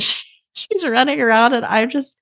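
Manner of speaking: real laughter breaking into high-pitched talk; laughing. Real, not acted. Aunt recalling her silly niece running around, laughing as she tells it. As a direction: giggle through the words, pitch riding up.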